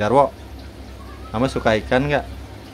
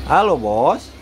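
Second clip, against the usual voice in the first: "Halo, Bos" swooping up, down and up; sing-song call; 3 semitones higher, slower than usual. Shown as a demonstration. Owner coaching bird to greet the boss, sing-song.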